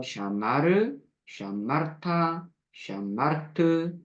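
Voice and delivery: 느리게 또박또박 "샤마르타, 샤마르트"; 한 단어씩 천천히 "샤마르타", "샤마르트". Shown as a demonstration. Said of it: "다시 한번 읽어볼게요". (다시 천천히 읽어 주는 발음)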